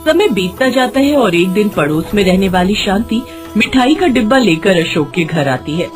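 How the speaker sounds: ordinary delivery, nothing marked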